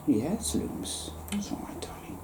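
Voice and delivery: hushed, breathy murmur to a pet; soft aside. Real, not acted. An aside to Looms, murmured softly. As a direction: drop to a near whisper, tender. Aside to someone nearby mid-talk.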